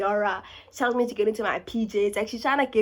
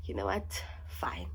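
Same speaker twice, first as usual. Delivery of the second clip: low, soft, defeated; mumbling a reluctant yes to a pushy friend; resigned giving in; 13 semitones lower, 11 dB quieter than usual. Retold or imitated. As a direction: give in with a tired sigh in the voice. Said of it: "So I'm just like".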